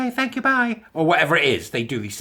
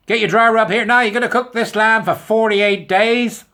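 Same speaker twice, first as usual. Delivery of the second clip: high, loud, hammed-up TV host; mock American food channel voice; American cooking-video host; 9 semitones higher, 8 dB louder than usual. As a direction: sell it like a cheesy crockpot presenter. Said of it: "they come on and go".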